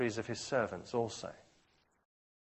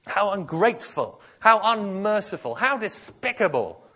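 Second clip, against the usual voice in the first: 1 indignant outcry; 9 semitones higher, 10 dB louder than usual. Real, not acted. Preacher condemns the servant.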